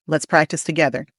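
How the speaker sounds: usual speaking voice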